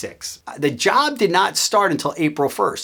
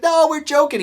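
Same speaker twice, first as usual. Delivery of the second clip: high-pitched imitation of the joking boss; joking reassurance; 11 semitones higher than usual. Boss lets new hire in on prank, imitated high call. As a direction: push it up high, letting him in on it.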